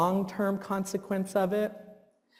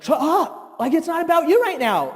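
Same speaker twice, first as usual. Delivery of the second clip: imitated snap; 11 semitones higher, 4 dB louder than usual. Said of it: "you're like".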